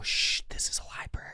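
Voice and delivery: unvoiced hushed shush; librarian shushing whisper. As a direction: hush them like a strict librarian. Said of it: "Gotta go".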